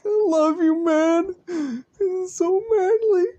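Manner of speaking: very high, loud, hammed-up happy sobbing; squealing mock-crying declaration; tearful bro love. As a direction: wail it like an overjoyed friend bursting into tears. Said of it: "As he tears up".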